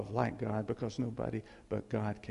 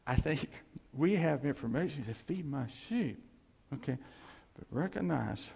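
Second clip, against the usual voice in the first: usual speaking voice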